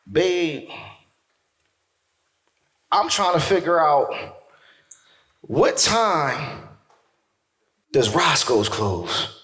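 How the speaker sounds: slow, drawn-out stoned question; lightly voiced cousin, dragging the words out. Cousin's stoned question, acted slowly.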